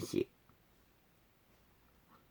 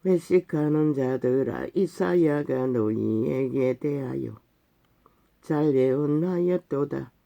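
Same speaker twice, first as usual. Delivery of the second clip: scripture recitation; 2 semitones lower, 4 dB quieter, slower than usual. Acted. Preacher reading Isaiah's rebuke of hypocrites, slow solemn recitation. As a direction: intone the verse slowly, word by word.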